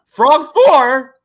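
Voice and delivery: high, loud outburst of glee; high-pitched fanboy yell; excited exclamation. Real, not acted. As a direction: shout it out like a thrilled fan. Repeats it, excitedly shouted.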